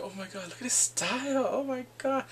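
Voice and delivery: high, gushing admiration; swooning friends. Retold or imitated. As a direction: mimic friends fawning, rising in pitch. Friends swooning over him.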